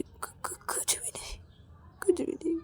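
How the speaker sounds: stammered, barely audible denial; frightened whisper. Acted. Ravi denies anything is wrong, stammering whisper. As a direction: stammer it under your breath.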